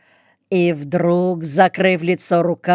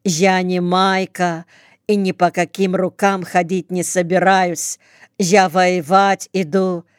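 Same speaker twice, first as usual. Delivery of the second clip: ordinary delivery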